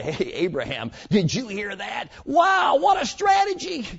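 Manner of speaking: mock the soldiers' hammed-up wonder; mock amazed soldiers. Soldiers nudging each other over the plan, mock excited shout. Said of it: "hitting each other and say".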